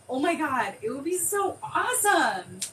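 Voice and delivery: high, loud burst of excitement; thrilled gushing. Real, not acted. Host imagining the crime-scene shirt, thrilled gushing. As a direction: gush over your brilliant idea.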